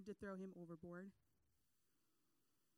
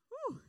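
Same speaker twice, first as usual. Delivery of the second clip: exaggerated whew; 11 dB louder than usual. Real, not acted. Reacting to Jonah's outburst.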